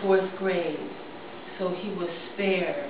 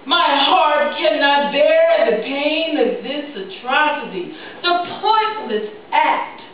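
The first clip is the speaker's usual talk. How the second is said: loud, raised dramatic declaiming with falling phrase ends; impassioned poem recital; 7 semitones higher, 9 dB louder than usual. Acted. Parent remembers a child lost at Sandy Hook, impassioned recital. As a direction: recite it with grief, pressing hard then dropping away.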